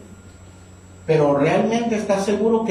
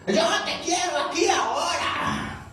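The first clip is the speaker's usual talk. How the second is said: demanding boss; 10 semitones higher, 5 dB quieter, slower than usual. Retold or imitated.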